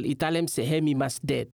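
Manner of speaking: ordinary talk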